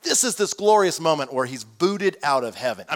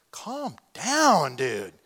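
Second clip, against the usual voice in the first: mock calming; much slower than usual. Onlooker to a yelling friend.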